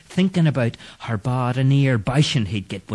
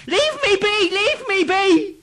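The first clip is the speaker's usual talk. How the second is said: very high, panicked cry; terrified scream; 3 dB louder than usual. Acted. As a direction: scream it in fright at the ghost. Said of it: "Clancy screamed".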